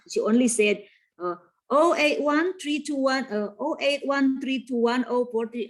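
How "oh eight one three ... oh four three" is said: rattled-off phone number; too fast. Acted. Customer giving her phone number to the office, too fast. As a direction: reel the digits off without grouping them. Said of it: "Ah, it is too fast".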